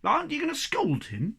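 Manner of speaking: can't believe he gets off free; incredulous question about scolding. A narrator's reading. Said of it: "the Hemulin asked incredulously".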